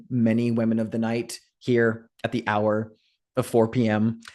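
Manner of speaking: ordinary talk, nothing marked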